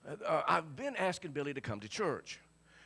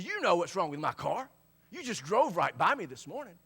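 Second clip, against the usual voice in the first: louder, higher, accusing the friend who drove past; mock angry Billy, raised voice; retold angry reply; 4 semitones higher, 6 dB louder than usual. Friend scolding [name] for not stopping, retold angry. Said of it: "He was mean and mad at me".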